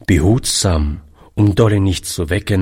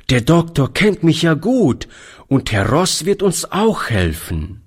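put-on boyish, higher and hopeful; young boy voice; 9 semitones higher than usual. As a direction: speak as an eager young boy. Boy comforting, high voice.

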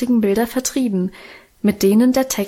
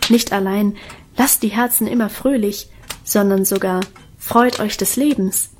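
plain reading voice

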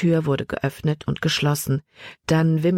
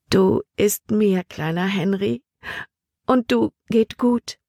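put-on slow, higher, loving baby talk; full of love; 5 semitones higher than usual. A narrator's reading. Emma talks to her baby son, full of love. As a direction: coo it slowly to an infant. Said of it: "voller Liebe".

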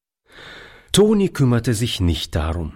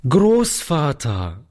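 a drawn-out call, slightly raised; sudden call; 6 semitones higher, slower than usual. A narrator's reading. Boy suddenly calls to grandfather, drawn out. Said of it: "rief er plötzlich".

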